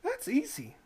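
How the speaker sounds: put-on high, eager young boy; a country lad answering, pitched high; boy's voice. Boy answering the toll keeper, high and eager.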